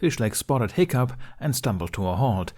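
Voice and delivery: usual speaking voice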